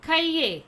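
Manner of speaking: "Cahier" said as "ka-YE", falling at the end; pronunciation demo. Shown as a demonstration. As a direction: model French "Cahier" clearly, silent r, ending in ye. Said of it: "How to pronounce?".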